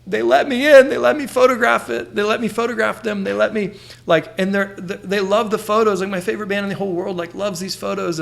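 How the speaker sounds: high-pitched, overwhelmed mock crying; tearful excitement. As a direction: gush in disbelief as if about to cry. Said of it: "I'm, like, crying".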